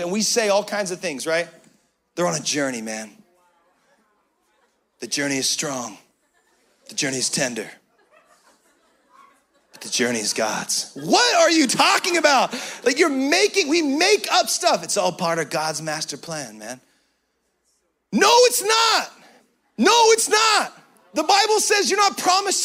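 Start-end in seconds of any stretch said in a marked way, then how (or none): 2.2-10.9 mock mellow dude. Mocking excuses for unbelieving friends. 14.8-16.8 mock mellow dude. Same mocking excuse voice. 18.1-20.7 higher, louder, slow firm no; emphatic refusal. Real, not acted. Preacher rebuts the excuse.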